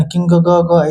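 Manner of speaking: "किंग ग ग" with repeated loose ग sounds after किंग; wrong split reading. Shown as a demonstration. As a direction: stutter out extra ग syllables after "किंग". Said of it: "ऐसे नहीं".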